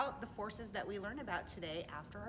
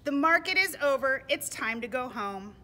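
loud, projected announcement; emphatic picture-book reading; storybook narration; 4 semitones higher, 12 dB louder than usual. A narrator's reading. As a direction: announce the journey home to little listeners. Reads storybook to children.

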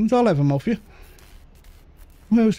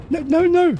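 sudden high alarmed protest, higher; 10 semitones higher than usual. Real, not acted. Gamer startled by a creature, high alarmed protest. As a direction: yelp it out as something jumps at you.

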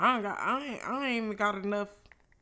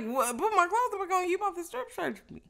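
much higher, louder disbelieving quote; retold shock at being told to dress; incredulous; 11 semitones higher, 5 dB louder than usual. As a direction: act stunned she's told to dress again. Shocked reply to put clothes back.

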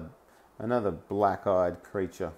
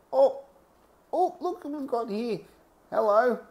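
high sing-song fake welcome; mock delight; 13 semitones higher than usual. Real, not acted. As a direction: greet him with sarcastic glee. Spotting a disliked figure, mocking greeting.

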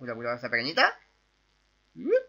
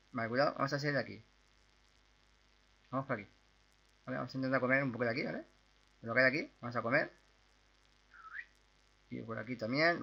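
ordinary talk, nothing marked

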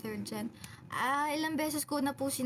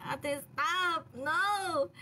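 put-on higher, half-laughing "stop, no" to a teasing older girl; mock protest; 6 semitones higher, much slower than usual. Protesting at Ate Andy's teasing, higher mock protest. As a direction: play at pushing her away, rising at the end. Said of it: "And I'm like".

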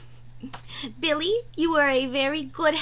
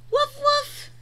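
puppy bark; 10 semitones higher than usual. Acted. Voicing the puppy Biscuit.

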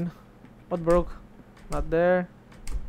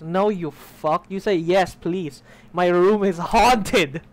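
loud, slow, hammered-out protest; exasperated outburst; 2 semitones higher, 9 dB louder, much slower than usual. Real, not acted. Player berating an unhelpful game character, exasperated loud protest. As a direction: build to a drawn-out yell on haunted.

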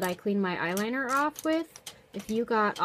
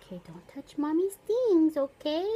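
high, loud gentle warning; 8 semitones higher, 6 dB louder than usual. Real, not acted. Mom warns baby on lap.

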